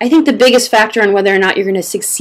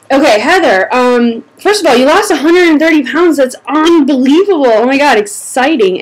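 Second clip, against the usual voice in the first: excited; 5 semitones higher, 3 dB louder than usual. Real, not acted. Congratulating a reader's big weight loss.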